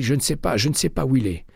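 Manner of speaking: high, choked pleading voice; frightened clerk. A narrator's reading. Terrified clerk under McCaleb's arm, high and pleading. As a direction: beg to be let go.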